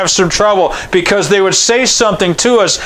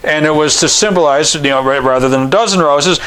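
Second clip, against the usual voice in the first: ordinary talk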